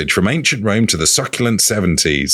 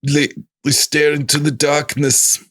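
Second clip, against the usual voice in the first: hammed-up German accent, pitched higher; Werner Herzog; 7 semitones higher than usual. Imitating the film director staring into darkness, mock German accent. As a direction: play a solemn German film director, higher than usual. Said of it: "the Bavarian actor. Or the director".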